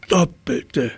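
high strained groan; groaning giraffe. A narrator's reading. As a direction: squeeze it out high, in pain. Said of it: "Die Giraffe stöhnte".

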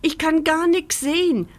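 höher und lauter klagend; beschwerend. A narrator's reading. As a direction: jammern über die Mütze. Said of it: "beschwert sie sich". (klagend beschweren)

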